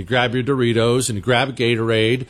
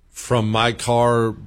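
ordinary talk

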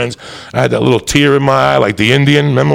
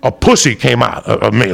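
normal talking voice

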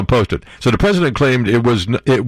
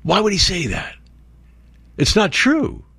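mock hurt; 7 semitones higher than usual.

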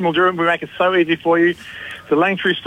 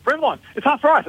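imitating a squealing, much higher girl's voice; excited young girl; 12 semitones higher than usual. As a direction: gush like a girl who can't believe the bargain. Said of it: "she was hyperventilating".

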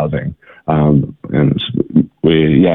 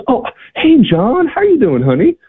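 very high, sugary hello; sweet greeting. Retold or imitated. Rhonda snapping out of it to greet John, very high sweet hello. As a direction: gush it up high like a sudden sweetheart. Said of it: "and she goes".

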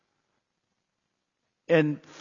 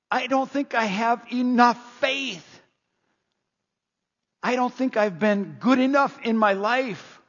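echoing a dying friend's tearful doubts; tearful plea; 5 semitones higher than usual. Retold or imitated. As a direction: voice lifting with grief as he confesses. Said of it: "through weeping and tears, he said".

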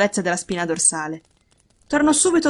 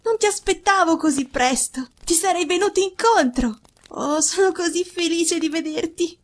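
high, gushing youthful voice; joyful boy's cry; 9 semitones higher than usual. A narrator's reading. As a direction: pour out delight at seeing father. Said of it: "gridò".

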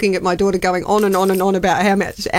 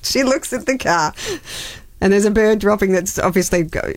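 ordinary talk

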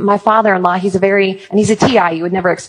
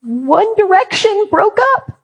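put-on much higher, slow, whiny teen girl voice; imitating sobbing teen girls; 13 semitones higher, slower than usual. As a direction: play the heartbroken fourteen-year-old fan, high and drawn out. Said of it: "And they go".

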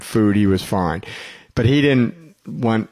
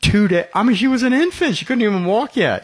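incredulous; 11 semitones higher than usual. Real, not acted. Dad telling his wife about the baby's grudge, amazed and high. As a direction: pitch it up in amazed disbelief.

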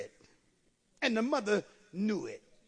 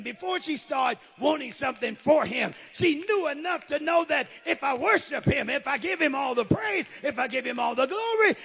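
raised, driving sermon cadence; building preaching; 4 semitones higher, 5 dB louder than usual. Real, not acted. Preacher voicing mother before worship, building fervour. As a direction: build each repeated phrase, higher and more forceful.

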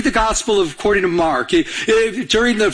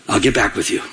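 low, deadpan voice of God; God's reply; 12 semitones lower than usual. Acted. God stalls the impatient believer, low and deadpan. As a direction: put them off in a low God voice. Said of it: "God's gonna tell us".

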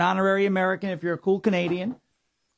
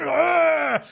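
mock ugh; 10 semitones higher than usual. Mock exasperated sound.

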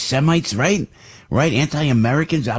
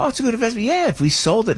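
high mock voice; mocking himself; 13 semitones higher, faster than usual. Son joking back to mom, high mock voice. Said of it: "And I go".

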